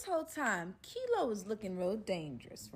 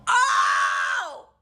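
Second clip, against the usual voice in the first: deep drawn-out mock shout of alarm; a dramatic, deep, hammed-up "oh" cry; 13 semitones lower, 13 dB louder than usual. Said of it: "And I go".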